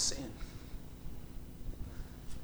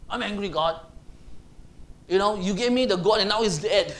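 louder indignant complaint to God; mild mock anger; 3 semitones higher, 6 dB louder than usual. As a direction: complain like a sulking man. Jonah voiced in tantrum.

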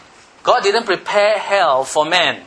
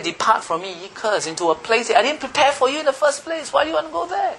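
voicing Jesus; 8 semitones higher than usual. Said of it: "He said".

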